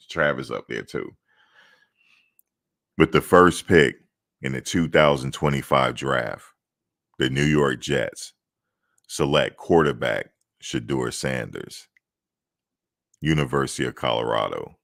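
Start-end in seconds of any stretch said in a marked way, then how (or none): 3.0-14.8 draft announcer. Pretend. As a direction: announce the pick like the commissioner on stage. Mock draft pick announcement.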